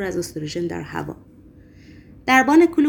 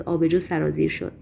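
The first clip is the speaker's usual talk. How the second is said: ordinary delivery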